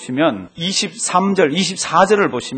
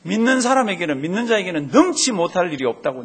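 ordinary talk

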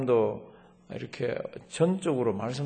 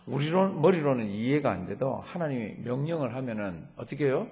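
usual speaking voice

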